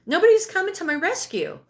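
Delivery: high, loud mock protest; crying child. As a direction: act out a baby calling out to be rescued. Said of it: "you're crying and you're like".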